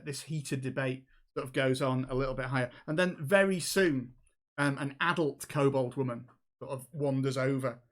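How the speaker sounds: normal talking voice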